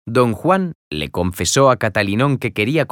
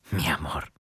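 breathy lover's whisper; hushed, intimate whisper; 4 semitones lower, 11 dB quieter than usual. Acted. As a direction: whisper it close, like a secret. Lover's tender declaration.

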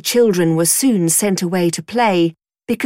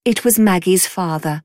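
plain reading voice

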